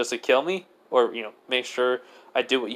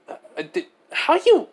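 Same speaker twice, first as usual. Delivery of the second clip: flabbergasted; 13 semitones higher than usual. Acted. Boy shocked by picture of her boyfriend, flabbergasted. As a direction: stammer that leaps up high.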